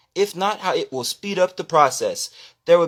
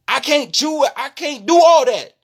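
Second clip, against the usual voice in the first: loud and higher, raw frustration; heated rant getting louder; emotional outburst; 5 semitones higher, 4 dB louder than usual. Real, not acted. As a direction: let it burst out. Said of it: "I hate to be so loud".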